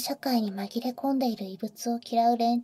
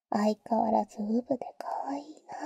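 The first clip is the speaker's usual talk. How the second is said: teasing whisper; 4 dB quieter than usual. Acted. Older sister teasing the boy in his ear, whisper.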